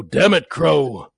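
slow, clipped, furious growl; angry staccato character. Acted. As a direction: bark each word out, staccato. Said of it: "he kind of talks in this really staccato way".

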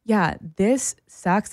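usual speaking voice

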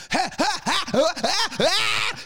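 high, mocking ha ha ha; fake high laughter. Mocking the audience laughing at the bit, high fake laugh. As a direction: cackle up high like the smug audience. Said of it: "And they're like".

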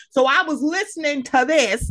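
high, swooping imitation of an eager listener; Samaj. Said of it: "'cause he'd be like".

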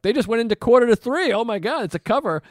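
loud, high, rushed mock amazement; hammed-up high-pitched fan commentary; excited concert fan. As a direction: call it out like a thrilled concertgoer. Mock concert commentary on long call.

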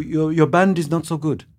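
put-on polite German accent. German interviewer critiquing a band, politely German-accented. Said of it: "in a very polite tone".

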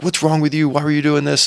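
imitated angry parent scolding; mock angry parent. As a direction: snap at the kid as if catching them. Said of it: "get angry and be like".

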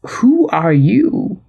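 slow, drawn-out puzzled question; mock bewilderment, stretched out; baffled. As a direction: imitate a mystified man, rising at the end. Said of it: "English is baffled and asks".

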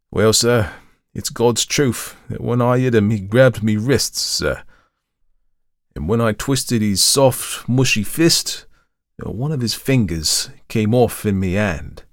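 slow, hushed-then-rising Cockney confession; Cockney servant, slow with dread; horrified Cockney. Acted. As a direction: drag out the ghastly detail in Cockney. Thomas's horror confession.

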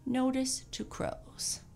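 high, slow announced heading; proclamation. A narrator's reading. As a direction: read out a public notice grandly, slowly. Reads out a written proclamation.

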